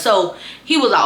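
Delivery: ordinary delivery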